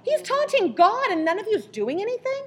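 high, loud, indignant challenge; acted outrage. David rebuking God's people for allowing taunts, outraged. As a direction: raise pitch and volume, stretching the key words.

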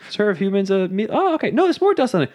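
very high mock-surprised voice; cartoon back-and-forth. Reading the dusty book title, high mock surprise. Acts cookbook dust scene.

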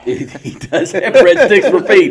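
laughing. Real, not acted. Laughing while repeating joke.

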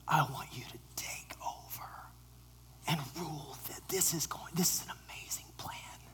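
hushed eager whisper. Acted. Peter whispering his grand plan to Jesus, hushed. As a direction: whisper it like a secret scheme.

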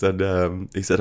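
usual speaking voice

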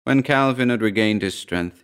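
plain reading voice

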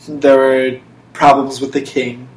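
ordinary talk

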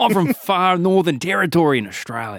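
a cheery outback larrikin, laid on thick; broad Aussie bushman. Pretend. Lost bushman greets the cop, hammed-up Aussie accent. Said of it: "the accent is, uh, throwing me off".

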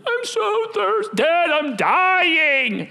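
put-on high, drawn-out whine of a child; whiny kid. Kids complaining to their dad, high whiny voice. Kids whining about thirst.

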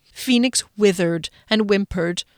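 plain reading voice

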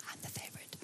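breathy joking whisper to the crowd; whispered aside. Real, not acted. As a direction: whisper it as a cheeky secret. Joking aside about favourite daughter.

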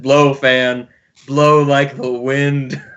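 slow, drawn-out hero command; dramatic hero line. Retold or imitated. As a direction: stretch it out like a superhero. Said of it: "I do like the line".

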